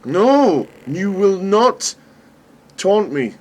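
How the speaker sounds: high, loud, slow defiance; defiant shout. Real, not acted. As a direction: shout down the taunting game. Defying the game after failing.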